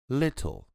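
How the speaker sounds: British "Little" with a clear, hard T; British pronunciation. Shown as a demonstration. Teacher repeats the British version, crisp T. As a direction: give "Little" a sharp British T. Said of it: "eles puxam mais o T".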